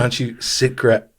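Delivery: normal talking voice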